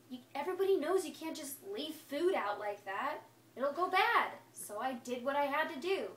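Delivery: ordinary delivery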